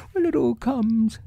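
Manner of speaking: high, grumbling bear voice; mildly cross storybook bear, pitched high. A narrator's reading. Pooh blaming the small door, high cross voice. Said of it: "said Boo crossly".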